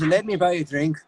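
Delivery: Scottish, placed back in the throat; Scottish accent. Shown as a demonstration. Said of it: "Scottish to me is more back in".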